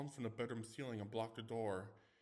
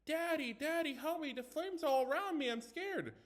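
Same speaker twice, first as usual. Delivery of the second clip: put-on much higher, louder child's voice crying for daddy; mock trembling little boy; 6 dB louder than usual. Teen mocking the ghost story, hammed-up child's cry for help. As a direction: ham up a scared child trapped in flames. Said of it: "put on a trembling voice, imitate the little boy".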